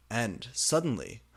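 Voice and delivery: usual speaking voice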